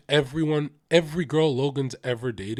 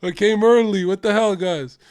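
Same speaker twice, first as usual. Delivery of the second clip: lightly voiced baffled God; raised, louder mock complaint; put-out protest, easing off at the end; bewildered God; 5 semitones higher, 5 dB louder than usual. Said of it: "God would be like".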